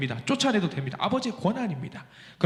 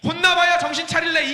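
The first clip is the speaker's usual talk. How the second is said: harsh threat; 10 semitones higher, 5 dB louder than usual. Acted. Rejected God-voice, harsh.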